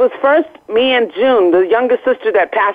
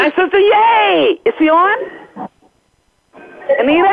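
excited; 9 semitones higher than usual. Real, not acted. Excited greeting of her sister.

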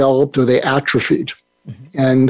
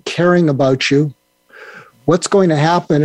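normal talking voice

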